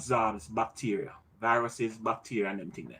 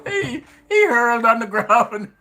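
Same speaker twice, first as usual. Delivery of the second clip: laughing through the words; high, laughing and slow; 8 semitones higher, 6 dB louder, slower than usual. Real, not acted. Laughing at the spill.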